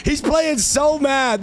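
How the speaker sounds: high, slow teasing voice; gloating at an angry opponent; mocking. Real, not acted.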